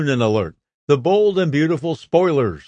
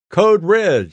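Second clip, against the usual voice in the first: higher, mildly raised alert; raised call into a walkie-talkie; urgent radio call; 6 semitones higher, 4 dB louder than usual. A narrator's reading. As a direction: call it in like a guard sounding the alarm. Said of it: "she calls into her walkie-talkie".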